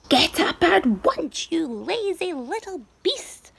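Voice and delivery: high, loud, slow furious yell; shouting. A narrator's reading. Aunt Spiker shouting at James to get up. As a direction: bark it down at the lazy boy. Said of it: "a voice was suddenly shouting".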